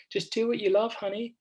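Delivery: high-pitched mock-American mum; American accent. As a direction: put on a high, cheesy American voice. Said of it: "I did an American voice".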